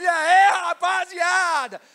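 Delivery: big greeting shout. Acted. Preacher repeating the servant's booming greeting. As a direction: bellow it to the room. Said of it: "É isso que eu imagino".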